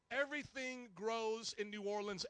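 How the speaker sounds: ordinary talk, nothing marked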